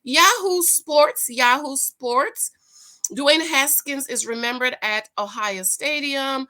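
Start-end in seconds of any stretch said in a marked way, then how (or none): 0.0-1.3 drawn-out Yahoo Sports, high, loud, slow, falling; sports announcer. Pretend. Announcing sports outlet name. 1.3-2.5 slow Yahoo Sports with a rising Yahoo; playful jingle tag ending up; sing-song announcer. Pretend. Second jingle take, rising.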